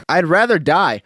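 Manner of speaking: ordinary talk, nothing marked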